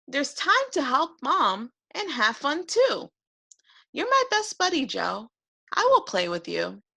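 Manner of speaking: put-on high, patient little boy voice; a kind boy calming his angry dragon; gentle boy. George soothes Joe.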